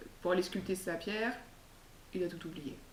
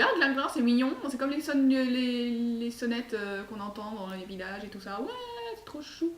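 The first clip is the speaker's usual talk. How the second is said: put-on high, cooing little-girl voice; 4 semitones higher than usual. Little girl charmed by the monk's name, high and cooing. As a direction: coo over the cute name. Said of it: "Elle fait".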